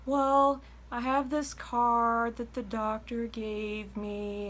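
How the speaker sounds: high, slow, drawn-out rambling caller voice. Retold or imitated. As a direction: drag it out like a caller who never gets to the point. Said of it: "they go".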